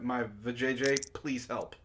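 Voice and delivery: normal talking voice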